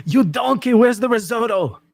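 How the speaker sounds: high, loud shouting chef voice; yelling at a cook in a Ramsay accent; Gordon Ramsay impression. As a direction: scream it like an angry TV chef. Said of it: "Chef Ramsay always talks about".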